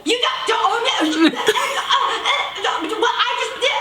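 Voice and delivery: high, stammering nonsense outburst; mock sputtering. Woman lost for words at the jogger, mocking high sputter. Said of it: "more syllables without saying a single word".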